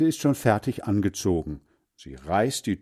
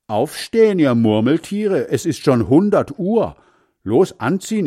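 high, loud teasing shout at the sleepers; wake-up shout; 7 semitones higher, 6 dB louder than usual. Acted. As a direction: rouse the lazy sisters with a yell. Boy wakes sleepy sisters.

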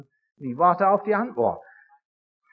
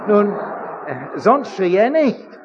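ordinary delivery

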